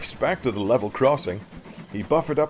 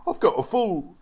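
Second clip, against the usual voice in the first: higher, eager offer that falters midway; volunteer keenly, then hedge; eager engine Richard; 5 semitones higher than usual. Acted. Richard's figure offers help.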